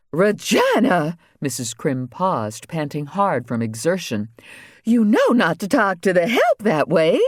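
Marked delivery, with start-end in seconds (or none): 0.1-1.2 higher, slow warning call of the name; sharp scolding call. A narrator's reading. The First Lady rebukes her daughter, higher and slow. 4.8-7.2 higher, reproving mother's voice; scolding mother. A narrator's reading. The First Lady scolds Regina about the help, higher and reproving.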